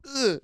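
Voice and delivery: high-pitched mock grunt; imitated squeaky grunt; Hezbollah. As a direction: squeeze out a little high-pitched noise. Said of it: "high-pitched voice".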